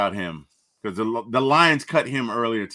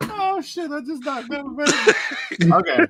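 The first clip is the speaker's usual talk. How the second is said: laughing; 13 semitones higher than usual. Real, not acted. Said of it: "retelling funny quote".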